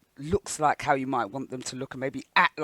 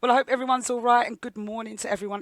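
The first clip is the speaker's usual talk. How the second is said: bright greeting; 7 semitones higher, 6 dB louder than usual. Real, not acted. Host's bright greeting to listeners.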